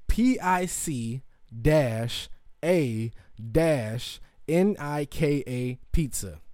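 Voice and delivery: letters spelled slowly one by one. Shown as a demonstration. Host spelling the pizza name slowly letter by letter. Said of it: "conservatively pronounced".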